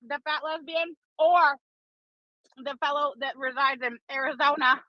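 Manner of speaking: normal talking voice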